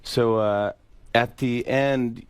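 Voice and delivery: ordinary talk, nothing marked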